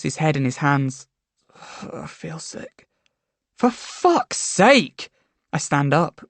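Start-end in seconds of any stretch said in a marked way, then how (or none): 1.5-2.9 breathy whispered sigh, barely voiced. A narrator's reading. Boyfriend heads in hands, whispering he feels sick. Charlie head in hands, sighing. 3.6-5.1 exasperated. A narrator's reading. Narrator exasperated at boyfriend.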